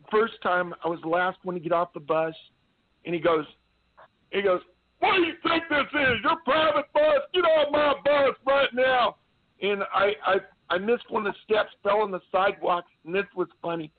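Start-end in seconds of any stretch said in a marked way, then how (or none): 5.0-9.2 high, loud sergeant chewing out a private; imitated drill sergeant shout; drill sergeant yell. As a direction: bawl it like an angry drill sergeant. Retold drill sergeant yelling at private.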